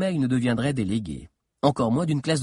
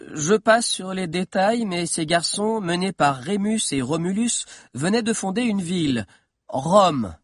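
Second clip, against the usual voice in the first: put-on lightly raised boyish storytelling voice; eager schoolboy lecturer, a little higher; young historian telling his tale; 6 semitones higher than usual. A narrator's reading. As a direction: carry the listeners along, landing the last word. Figure of Valère telling the story.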